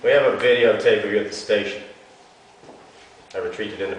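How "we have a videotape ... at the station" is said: pressing cop. Acted.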